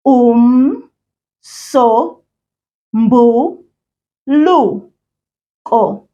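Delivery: stretched very slowly syllable by syllable; drawn out. Shown as a demonstration. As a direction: drag out Umsombuluko for learners. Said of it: "I'm going to say all of them first while you listen".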